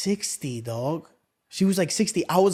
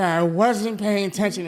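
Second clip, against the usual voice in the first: mock apologetic woman. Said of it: "She said".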